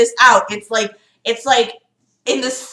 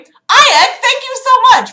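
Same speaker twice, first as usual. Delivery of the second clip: much higher, louder, slow delighted thanks; excited thanks; 7 dB louder, slower than usual. Real, not acted. Thanking a viewer for a donation, delighted and loud. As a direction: gush over the donation. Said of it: "thanking a donor".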